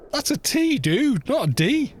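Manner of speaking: high-pitched, exasperated squeal of correction; mock protest. Mock protest at American pronunciation.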